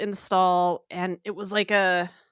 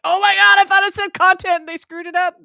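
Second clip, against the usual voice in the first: higher, louder, gushing group reaction; mock excitement; 8 semitones higher, 9 dB louder than usual. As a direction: gush like a crowd of fans at the same mistake. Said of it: "They were all just like".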